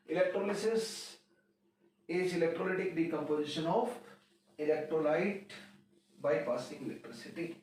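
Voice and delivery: words drawn out for note-taking; slow, deliberate dictation. Shown as a demonstration. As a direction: dictate it slowly so students can write. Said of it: "teacher dictates definition again".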